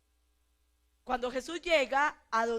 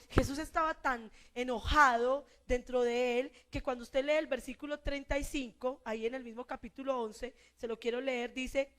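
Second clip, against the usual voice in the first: ordinary delivery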